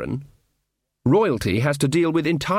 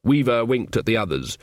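ordinary delivery, nothing marked

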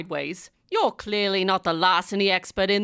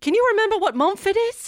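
much higher, louder and fast; worried fussing; 11 semitones higher, 4 dB louder, faster than usual. Acted. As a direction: fuss over him after the fall. Checks the fallen detective.

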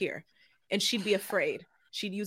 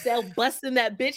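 ordinary talk

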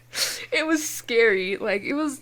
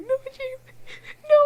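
crying. Retold or imitated. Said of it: "I just broke down crying".

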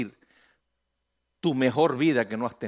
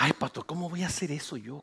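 softer, disdainful put-on voice; snobbish refusal; 8 dB quieter than usual. Proud member refusing to sell tamales, soft disdainful voice. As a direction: sniff at the idea as beneath you.

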